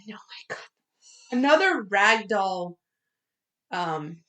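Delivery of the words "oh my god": hushed, breath-only aside; barely voiced dismay; appalled whisper. Real, not acted. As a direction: whisper it under your breath. Aside at grisly overpowering.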